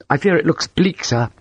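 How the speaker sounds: high, uneasy politeness with a nervous smile; nervous young officer. A narrator's reading. Young defender to his client, nervous. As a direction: deliver bad news high and apologetically. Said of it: "He smiled nervously".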